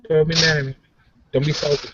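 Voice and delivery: normal talking voice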